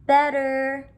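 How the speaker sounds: put-on high baby echo; toddler voice. A narrator's reading. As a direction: say it like a little toddler. Baby Honey Bear echoes.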